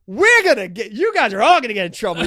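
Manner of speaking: high, loud shrill-sounding mom threat; loud, high mock mom scolding; mock angry mom. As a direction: ham up mom scolding the guys. Mom voiced threatening the dumpster guys.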